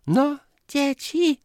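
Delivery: high, loud, drawn-out call; slow, high-pitched call to table. A narrator's reading. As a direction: summon the children in a high voice. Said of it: "zawołała".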